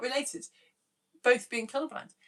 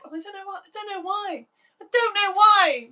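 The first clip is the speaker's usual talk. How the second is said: hammed-up despair, building each repeat; a melodramatic howl of regret; mock lament; 10 semitones higher, 8 dB louder than usual. Laments giving away dress.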